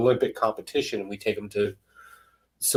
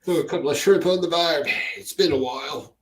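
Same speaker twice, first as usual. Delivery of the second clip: louder, higher mock Australian; fake Australian accent; 7 semitones higher, 4 dB louder than usual. Joker playing a homecoming Aussie at customs, fake Australian accent. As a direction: ham up a cliched Australian accent. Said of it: "nice fake accent".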